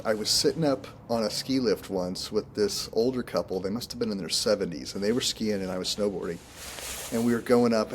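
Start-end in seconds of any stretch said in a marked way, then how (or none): none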